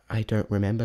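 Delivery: ordinary talk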